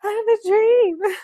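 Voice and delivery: high, loud joking outburst; mock proclamation. Reader jokes about the heroine's dream, mock proclamation. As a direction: belt it out as a joke.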